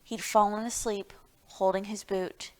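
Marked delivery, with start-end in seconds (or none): none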